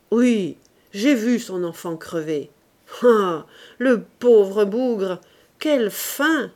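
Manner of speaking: loud, slow booming voice; hearty voice filling the room; big booming voice. A narrator's reading. Jory going on loudly in his big voice. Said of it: "continuait une conversation de sa grosse voix".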